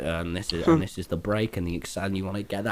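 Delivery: low-pitched, subdued instructor voice; imitated driving instructor. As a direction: mimic an older instructor explaining the car. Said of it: "And he's like".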